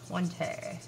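normal talking voice

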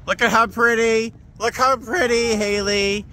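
high-pitched admiring coo; gushing. Real, not acted. Vlogger showing off friends, gushing. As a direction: gush over them in a high voice.

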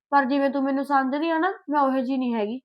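ordinary delivery

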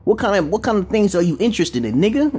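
high-pitched exasperated telling-off; animated mock dressing-down, pitched well up; acted street-talk scolding. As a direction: act out chewing out a dropout. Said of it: "I gotta talk like that and shit".

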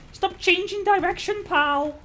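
high, loud yell at a dodging enemy; real exasperated outburst; shouting at the screen, annoyed; frustrated shout. Real, not acted. Frustrated at enemy during fight.

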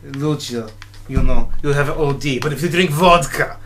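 hammed-up Russian accent; bad Russian accent. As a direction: put on a clumsy Russian accent. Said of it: "my bad Russian accent".

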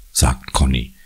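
ordinary delivery, nothing marked

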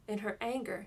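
ordinary delivery, nothing marked

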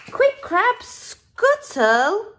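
high, loud, excited storytelling; urgent urging. A narrator's reading. Narrator hurrying Crab to the shell, urgent and high. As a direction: urge the little crab on loudly.

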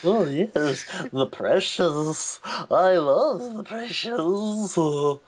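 goofy British creature, words drawn out; Gollum. Retold or imitated. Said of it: "he sounds a bit like a, a, a, a British".

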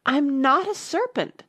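high, slow indignant protest; offended, emphatic denial. A narrator's reading. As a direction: protest hotly, pitched well up. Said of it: "said Alice indignantly".

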